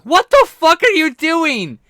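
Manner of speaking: high, loud and slow furious yell; outraged yell. Retold or imitated. Couple in the shower yelling at the roommate. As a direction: scream it at the intruder. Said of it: "he and her are like".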